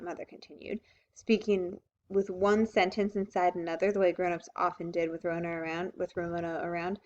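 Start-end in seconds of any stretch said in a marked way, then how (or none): none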